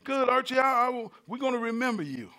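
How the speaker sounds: high-pitched imitation of a wife; high voice, humoring her husband; wife's voice. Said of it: "She said".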